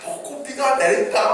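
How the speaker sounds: playful shout of 벚꽃비가 while shaking a tree; excited playful call. Retold or imitated. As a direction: sing it out for a delighted child. Pastor shaking tree for child.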